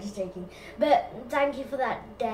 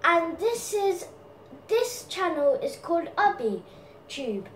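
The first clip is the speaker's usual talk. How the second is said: higher, louder channel intro; Ubby intro; 6 semitones higher, 4 dB louder than usual. Acted. Doing his own channel intro, higher and louder. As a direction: present the channel like a host. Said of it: "I forgot my own, um, stupid intro".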